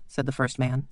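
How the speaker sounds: ordinary delivery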